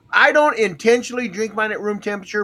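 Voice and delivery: ordinary delivery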